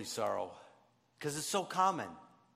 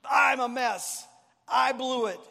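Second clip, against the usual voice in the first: higher, louder, slow cry of regret; anguished lament; 9 semitones higher, 6 dB louder than usual. Retold or imitated. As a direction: wail it like a broken man. Wept bitterly.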